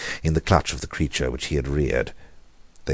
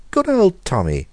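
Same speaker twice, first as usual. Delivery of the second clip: much higher, loud anxious cry; cried out high; 6 dB louder than usual. A narrator's reading. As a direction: call "Good old Tommy!" high and loud, pitch falling on the end. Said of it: "he cried".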